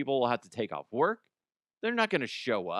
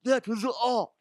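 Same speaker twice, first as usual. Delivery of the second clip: snooty museum staff; 8 semitones higher, 4 dB louder than usual. Retold or imitated. Museum scolding the janitor, high snooty mock voice. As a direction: look down on the janitor. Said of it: "They're like".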